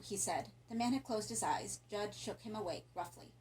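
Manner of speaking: ordinary delivery, nothing marked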